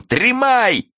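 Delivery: high-pitched holler; high drawn cry; wailing yell. A narrator's reading. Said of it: "с воплем".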